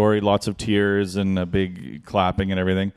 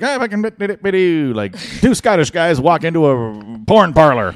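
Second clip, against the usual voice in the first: hammy emcee gag; 8 semitones higher, 5 dB louder than usual. Retold or imitated. MC rattling off street jokes.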